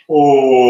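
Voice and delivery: loud, sung, falling "Oh"; disappointed sigh. Shown as a demonstration. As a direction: sing out the letdown. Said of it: "they still have no money".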